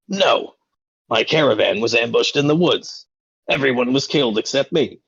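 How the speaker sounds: indignant boss, jumping high on the first word; exasperated. Acted. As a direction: set the clueless apprentice straight. Acted boss rebukes his clueless apprentice.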